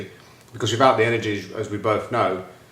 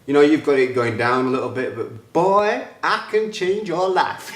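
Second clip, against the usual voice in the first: higher, slow mock coach voice, admitting he can't do her accent; a hint of the coach's accent, higher and drawn out; imitating the coach; 5 semitones higher, 4 dB louder than usual. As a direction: play the confident coach, stretching the last words. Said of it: "I can't do her accent, but she's like".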